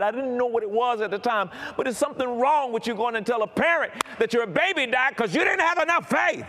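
loud, high-pitched preaching, building to a shout; preaching at full force. Acted. Preacher to congregation, high-pitched shouting sermon.

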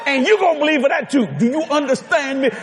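high-pitched scolding wife; hammed-up bossy telling-off; mock chewing-out. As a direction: chew out a husband in a high, insistent voice. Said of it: "She chewed him out".

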